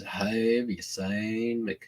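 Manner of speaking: slow, dumbed-down North Carolina drawl; slow it right down, North Carolina style; slow North Carolina accent. Shown as a demonstration. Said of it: "North Carolina is".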